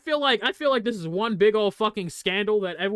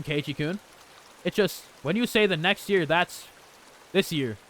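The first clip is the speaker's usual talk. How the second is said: lower, steady read-aloud voice; visual novel reading; 5 semitones lower than usual. Acted. Streamer reading visual novel lines aloud, low and even. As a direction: read the game's text calmly, a touch lower.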